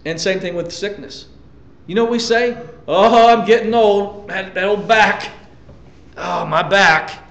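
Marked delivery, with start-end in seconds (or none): 2.9-7.2 a hint of an aching old-timer; mock old-age complaining. Believer complaining about sickness and age, mock weary. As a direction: groan lightly about getting old. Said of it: "why we talk like that".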